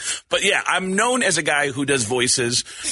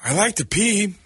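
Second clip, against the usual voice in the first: put-on raspy baby Pacino; Al Pacino's baby; 4 semitones higher than usual. A radio bit answering the host as a Pacino baby. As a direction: Pacino squeezed into a toddler. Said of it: "Al Pacino's baby".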